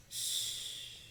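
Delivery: drawn-out shh; long shush. Pretend. Teacher shushes children for sleeping bear, long shh. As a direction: hush everyone gently.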